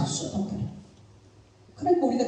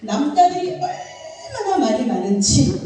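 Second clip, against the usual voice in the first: laughing complaint; 6 semitones higher, 6 dB louder than usual. Real, not acted. Laughing complaint about talkative men.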